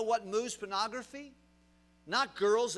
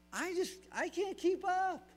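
quoting the lady; 12 semitones higher than usual. Retold or imitated. Quoting the overwhelmed elderly lady.

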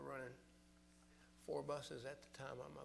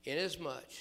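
preaching at full force; 7 dB louder than usual. Acted. Preacher reading the king's answer, loud then fading.

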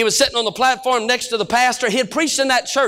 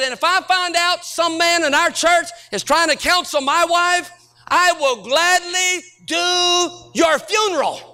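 higher, very slow menace ending in a shout; pulpit threat; 6 semitones higher, slower than usual. Retold or imitated. Preacher warning men who counsel his wife, slow menacing threat. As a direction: drag out the threat, then bark the last word. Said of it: "I think that's called a threat".

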